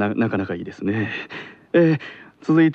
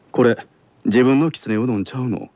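measured Kansai-accented question; Kansai dialect. Shown as a demonstration. Teacher showing pupil a simple question, Kansai dialect. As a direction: model the line in easy Kansai dialect. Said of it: "これを関西弁では".

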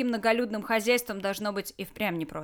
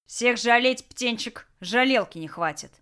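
louder, dismissive cut-off; curt retort; 4 semitones higher, 6 dB louder than usual. Acted. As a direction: snap it back dismissively. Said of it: "отрезал Толян".

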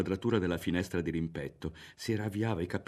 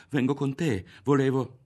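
higher, louder, embarrassed insistence; blushing, flustered reply; 7 semitones higher, 6 dB louder than usual. Acted. Boy blushing as he insists to Lidia, flustered and louder. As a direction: blurt it out while blushing. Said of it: "rispose Ario diventando di fuoco".

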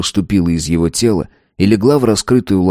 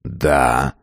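deep stern voice; 6 semitones lower than usual. A narrator's reading. Mighty man answers old man.

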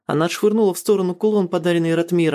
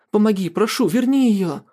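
desperate plea; 5 semitones higher than usual. A narrator's reading. Begs Marian to revive her.

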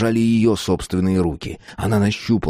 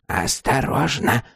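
put-on higher, slow old woman's caution; 4 semitones higher, slower than usual. A narrator's reading. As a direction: draw the warning out in an old crone's voice. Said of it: "сказала старуха".

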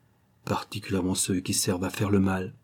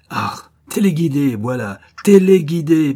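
TÉLÉGUIDÉ punched louder and higher; emphatic accusation; 6 semitones higher, 8 dB louder than usual. Acted. Officer accusing a planted witness, hammering téléguidé.

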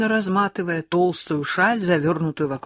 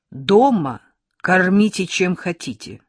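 firm; 3 semitones higher, 8 dB louder than usual. A narrator's reading. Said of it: "твёрдо говорит учительница отцу".

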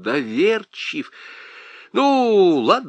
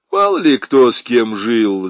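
philosophical; 7 dB louder than usual. A narrator's reading. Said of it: "заметил лейтенант философски".

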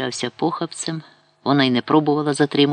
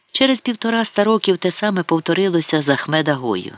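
ordinary delivery

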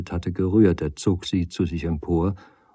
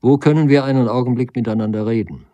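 louder, higher call across to someone; calling to Sarah; 5 semitones higher, 8 dB louder, faster than usual. A narrator's reading. Nagel asks Sarah for a room, calling out. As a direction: call it out, raised but polite. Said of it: "rief Sarah zu".

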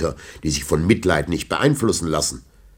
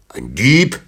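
indignant cry, higher and louder; cried out; 5 semitones higher, 6 dB louder than usual. A narrator's reading. Old knight crying out at being called a thief. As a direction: cry out, offended. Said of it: "rief der Alte".

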